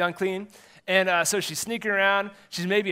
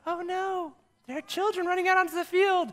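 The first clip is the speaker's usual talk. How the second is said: high, fussy mock voice of a worried spectator; mock alarmed onlooker; 13 semitones higher than usual. Spectator fretting about kids on the field, high mock alarm. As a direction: play a fretting parent in the stands. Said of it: "first, be like".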